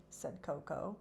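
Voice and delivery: ordinary delivery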